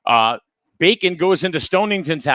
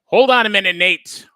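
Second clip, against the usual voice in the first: louder, higher call across the room; 5 semitones higher, 7 dB louder than usual. Real, not acted. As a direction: call out to get his attention. Calling out to the field reporter.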